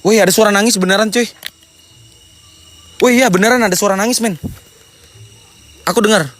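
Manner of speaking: high and loud, startled by crying; real excitement at a ghostly sound; excited alarm. Real, not acted. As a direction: blurt out that you really hear crying.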